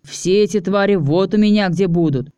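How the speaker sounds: ordinary delivery, nothing marked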